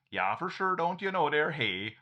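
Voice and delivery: put-on hammy Minnesota accent, for sure. Imagining his baby avatar joking in a Minnesota accent. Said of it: "give the baby a Minnesota accent".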